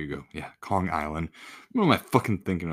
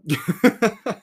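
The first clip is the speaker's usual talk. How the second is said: loud laugh; 11 semitones higher, 8 dB louder than usual. Real, not acted. Laughs at own rant.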